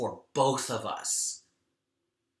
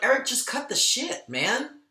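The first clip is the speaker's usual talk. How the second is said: sharp, much higher mock scolding of himself; harsh self-talk; 10 semitones higher than usual. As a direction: snap yourself out of it, half-playful. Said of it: "have a really harsh conversation with myself".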